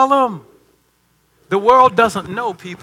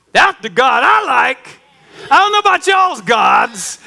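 loud, slow shouted preaching, higher; full-force preaching; 7 semitones higher, 4 dB louder, slower than usual. Acted. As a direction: preach it out to the congregation. Preaching climax.

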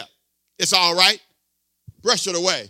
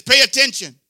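loud, higher command Read the label; preached command; 4 semitones higher, 6 dB louder than usual. Real, not acted. Preacher voicing stamina's rule to the church, loud. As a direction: shout it like a rule. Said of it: "Stamina says".

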